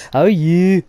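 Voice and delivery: high, loud East Anglia greeting; East Anglia accent. Pretend. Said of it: "trying to do an East Anglia accent".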